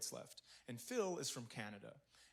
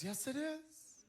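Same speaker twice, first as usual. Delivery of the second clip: high, drawn-out mock crying voice; mock tearful; 11 semitones higher, much slower than usual. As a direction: stretch it out as if answering through tears. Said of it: "And she started crying".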